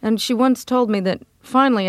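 ordinary delivery

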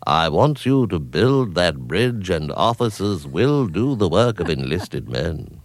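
slow, clipped English officer voice; measured English colonel; Alec Guinness voice. Acted. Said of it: "English voice like Alec Guinness".

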